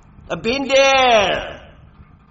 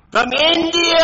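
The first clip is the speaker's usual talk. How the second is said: loud voice; 7 semitones higher, 4 dB louder than usual. Real, not acted. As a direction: call it out at full voice. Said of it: "speaking like this in a loud voice".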